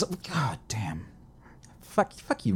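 slow whispered curse. Real, not acted. Complaining about New York Comic Con, whispered curse. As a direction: breathe it out, slow and unvoiced. Frustrated aside.

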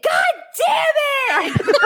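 high, drawn-out comic yell; retold furious shout. Thwarted diner told the restaurant is closed, hammed-up shout. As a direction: wail it out slowly in hammed-up rage.

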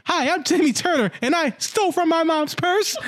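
high cartoon kid voice; Timmy Turner. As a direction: imitate a chirpy recorded confession. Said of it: "pops it with a second fucking recorder".

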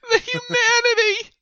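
very high, loud theatrical wail; hammed-up yell. Player's character yelling about the rats in the room, hammed-up wail. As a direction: cry out in melodramatic horror. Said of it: "I'll yell".

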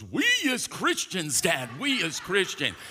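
put-on high-pitched little-boy outburst; imitated child's scream. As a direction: cry out like an alarmed child insisting to Dad. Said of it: "he screams out".

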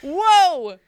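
high, loud shocked yelp; shocked whoa. Real, not acted. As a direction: blurt it out, scandalized. Reacts to co-host's insult.

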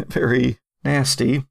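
usual speaking voice

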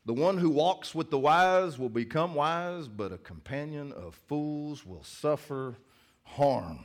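slow, weighty verse reading; slow solemn scripture reading. Acted. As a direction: read the verse with gravity. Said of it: "let's go to Proverbs".

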